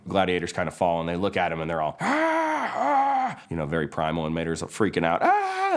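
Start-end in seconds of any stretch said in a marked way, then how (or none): 2.0-3.4 high, strained caveman cry; primal roar. Acted. Gladiators roar at Mater. 5.2-5.8 short high yelp of fright; startled scream; panicked cry. Acted. Mater panics, chased by gladiators.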